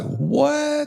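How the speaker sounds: imitating the brother's outraged disbelief; shocked what. Brother hears the shop won't take cash, shocked high what. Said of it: "He's like".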